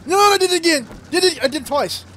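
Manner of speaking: high, loud outcry; loud, high-pitched dismay; exasperated disbelief. Real, not acted. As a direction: yelp it out at your own repeated blunder. Repeats the same mistake.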